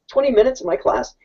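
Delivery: ordinary delivery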